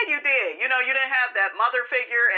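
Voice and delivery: ordinary delivery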